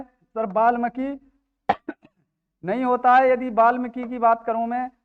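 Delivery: ordinary talk, nothing marked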